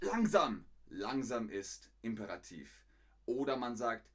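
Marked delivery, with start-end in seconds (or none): 0.0-0.6 loud, high command; imperative. Shown as a demonstration. Learner telling a fast speaker to slow down, loud command. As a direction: call it out as an order.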